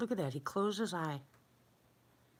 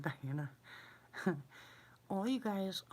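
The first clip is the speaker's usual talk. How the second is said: usual speaking voice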